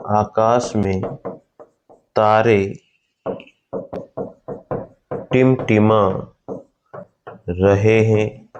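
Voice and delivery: slowly drawn out phrase; slow dictating teacher; slow dictation. Shown as a demonstration. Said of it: "ये आगे लिख लो".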